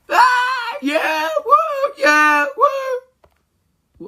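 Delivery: high whooping cheer. Acted. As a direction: whoop it up over the escape. Celebrating escape, cheering.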